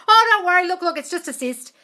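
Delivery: imitating a delighted vet; relieved good news. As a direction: blurt the good news joyfully.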